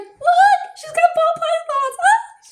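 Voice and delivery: very high, loud shriek; excited squealing. Retold or imitated. Acquaintance tells a coworker about pythons, shrieking with excitement. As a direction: squeal it out to show her off. Acts out thrilled friend showing snakes.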